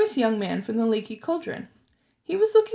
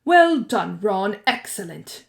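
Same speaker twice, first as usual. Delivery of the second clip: pompous; 5 dB louder, slower than usual. A narrator's reading. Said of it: "said Percy Weasley pompously".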